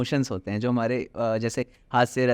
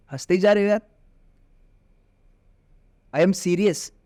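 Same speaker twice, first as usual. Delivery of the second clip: exasperated anger; 2 semitones higher than usual. Shown as a demonstration. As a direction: snap at him to stop laughing. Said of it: "मुझे गुस्सा आएगा".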